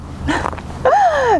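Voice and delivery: high quick laugh. Real, not acted. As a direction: burst out laughing. Laughs during demo.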